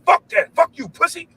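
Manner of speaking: imitating son cursing.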